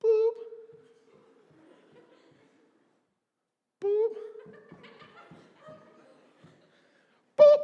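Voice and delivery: high, spaced-out beeps; beeping heard in slow motion. Retold or imitated. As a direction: drag each beep out in slow motion. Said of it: "I can hear it in slow motion".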